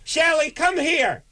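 a nurse calling Shelley over, excited; gossiping nurse. Retold or imitated. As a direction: call a coworker over for gossip. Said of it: "Judy, if he".

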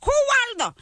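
scared mother. Acted. Mother to son's friend on phone, scared and accented. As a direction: play a mother feigning ignorance under threat. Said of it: "pretend like someone's holding a gun to you".

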